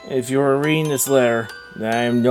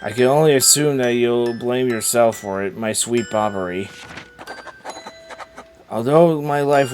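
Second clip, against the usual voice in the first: tender letter reading; 3 semitones lower, 6 dB quieter than usual. Acted. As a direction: read a dead wife's letter gently. Reads the dead wife's letter.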